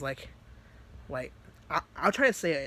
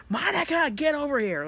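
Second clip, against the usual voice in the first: high, loud mock scream; screamed call; 11 semitones higher, 6 dB louder than usual. Natsuki calling Monica over, screaming. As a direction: yell urgently for help, pitch way up. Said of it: "Like, she screamed".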